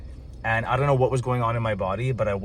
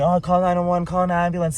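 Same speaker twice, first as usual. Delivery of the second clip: higher, louder panicked call for help; retold panic of bystanders at the counter; bystanders screaming; 8 semitones higher, 6 dB louder than usual. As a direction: retell the crowd yelling for an ambulance. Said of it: "people are screaming".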